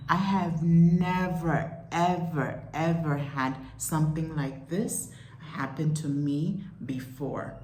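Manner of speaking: "never" stirato lentamente, "ever" ripetuto con enfasi; stressed "never, ever". Real, not acted. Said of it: "I am in shock right now".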